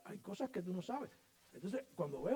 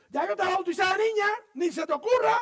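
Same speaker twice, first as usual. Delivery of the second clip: high, loud scolding mother's voice; angry mother; 16 dB louder than usual. Retold or imitated. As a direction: forbid it like a furious mom.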